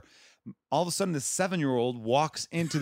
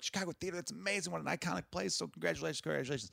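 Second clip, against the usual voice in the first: higher, hushed gush of praise; gushing congratulations; 3 semitones higher, 9 dB quieter than usual. Retold or imitated. Family praising the comic's iconic venue, gushing. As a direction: softly rattle off the family's congratulations.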